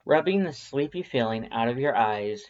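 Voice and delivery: ordinary delivery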